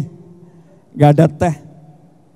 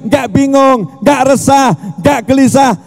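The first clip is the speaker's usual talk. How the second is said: high, raised preaching voice, slowing at the end; fervent preaching; 6 semitones higher, slower than usual. Real, not acted. Preacher urging the crowd to know God, raised fervent voice. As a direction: keep the fire going while listing each word. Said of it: "teriak-teriak".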